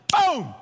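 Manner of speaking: loud, high-starting boom; imitated blast. God strikes down the disobedient, loud sudden blast. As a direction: punch out a sudden falling boom.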